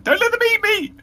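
very high, loud, plaintive cry; plaintive high-pitched. Acted. Dindy pleads to the orcs, very high and plaintive. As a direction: wail it in a tiny squeaky plea. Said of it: "And then repeats it again".

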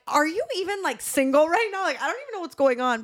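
high incredulous questions; mock disbelief. To the man with three ex-wives, high incredulous disbelief. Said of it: "And I'm like".

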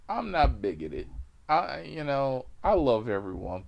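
normal talking voice

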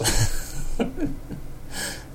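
soft unvoiced giggle; breathy laugh. Real, not acted. Laughing off the spicy story with listeners. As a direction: laugh it off quietly.

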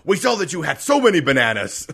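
loud, high mock interrogation; interrogator voice. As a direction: grill them like a cop. Acted interrogator.

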